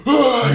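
brief hammed-up yelling sound; mock old man yell. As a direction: bark out a wordless yell like a grumpy dad. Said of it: "you know, just yell".

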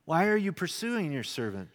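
ordinary talk

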